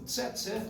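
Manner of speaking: "Tse, tse" with a crisp ts onset; C pronounced ts. Shown as a demonstration. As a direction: say "Tse, tse" with t and s fused. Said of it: "esse pronúncio com esse som".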